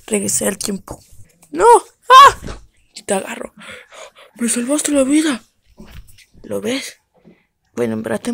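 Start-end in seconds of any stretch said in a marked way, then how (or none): 2.1-2.6 sudden high loud scream; shrill death scream. Acted. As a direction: scream as you die. Dies, screams.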